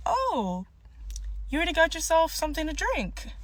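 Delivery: high-pitched, quick surprised question; polite surprise. Retold or imitated. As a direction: lift the pitch as if politely taken aback. Retelling her reaction to his drink.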